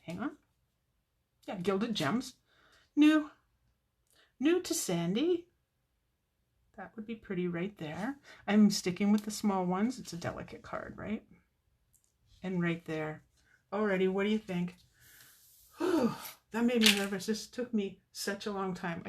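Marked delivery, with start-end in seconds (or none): 3.0-5.5 high, excited announcement. Real, not acted. Announces new product excitedly. 15.8-16.1 high whew of relief; sigh of relief. Real, not acted. Relief after a nervous step.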